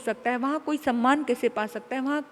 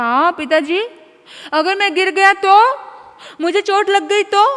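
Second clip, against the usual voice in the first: put-on worried child; 8 semitones higher, 11 dB louder than usual. Scared son on the rooftop.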